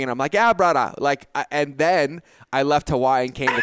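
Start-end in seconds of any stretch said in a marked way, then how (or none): 0.3-0.9 higher, louder Hawaiian Pidgin greeting. Shown as a demonstration. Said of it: "and spoke Pidgin".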